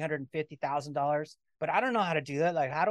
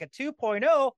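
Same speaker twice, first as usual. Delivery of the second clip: surprised question; 7 semitones higher, 4 dB louder than usual. Retold or imitated. Surprised at new program.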